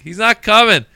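loud, high call across the room; retold yell through the room. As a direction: shout it across a crowded room. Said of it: "this is all being yelled through the room".

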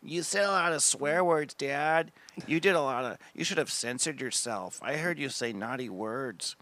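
lightly voiced child scolding; a hint of a prim little kid; son's scolding voice. Comic voices his son scolding him about swearing. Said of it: "he follows it up with".

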